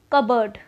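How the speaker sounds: cupboard said as KUB-ud, silent p; demo word. Shown as a demonstration. Teacher introducing an example word, said clearly. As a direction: say cupboard as KUH-bud. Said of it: "Let's say if I am just saying any word".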